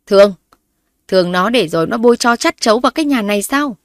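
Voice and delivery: loud, bitter rhetorical outburst; father scoffing loudly at his wife's plea; scornful angry father. Acted. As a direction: spit the word back in disgust. Father scornfully rejects mother's plea.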